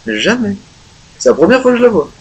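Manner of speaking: genuinely taken aback; surprised. Real, not acted. Surprised at new door.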